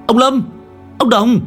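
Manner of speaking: calling out. Acted. Young master calling the old shamans into the tomb. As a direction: shout down into the crack.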